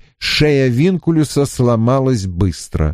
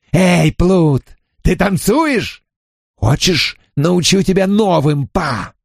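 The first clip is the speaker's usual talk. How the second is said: cruel mockery; 6 semitones higher than usual. A narrator's reading. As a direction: taunt him cruelly. Gentleman mocking before hanging.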